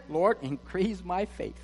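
measured preacher's scripture read. Acted. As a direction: proclaim the verses to the church. Reads scripture aloud to church.

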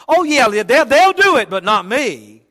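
high, loud mock bragging; mock boastful disciple. Preacher mimics a disciple boasting to Jesus, loud and high. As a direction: ham up the proud boast that others fail but not me.

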